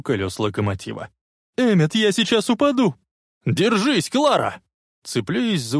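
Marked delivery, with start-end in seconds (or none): 1.6-3.0 high cry for help; frightened shriek. A narrator's reading. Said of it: "Визжа от страха". 3.5-4.6 high, desperate call; urgent shout. A narrator's reading. Shouting to dangling woman.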